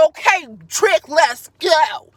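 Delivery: hammed-up confrontational bravado; imitating Gabby's combative personality. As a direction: square up as if calling someone out, building to a drawn-out shout. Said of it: "That's her personality".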